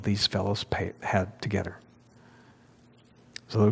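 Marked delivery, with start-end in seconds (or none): 0.0-1.8 whisper. Real, not acted. Voice drops to whisper.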